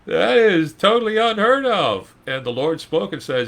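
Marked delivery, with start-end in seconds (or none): 0.1-2.2 incredulous Sarah. Retold or imitated. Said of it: "And she's like".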